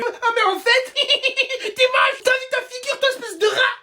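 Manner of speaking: high, indignant comic tirade; mocking comeback. Insulted pupil to classmate, mocking comeback.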